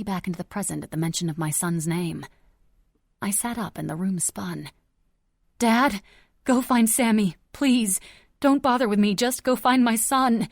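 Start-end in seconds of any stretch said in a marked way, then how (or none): 5.6-10.5 pleading. Acted. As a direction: beg him to go find the boy. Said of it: "I pleaded".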